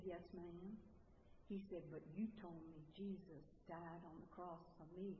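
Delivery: put-on quiet, small child's voice answering the teacher; soft little boy. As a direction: speak it softly like a shy little boy.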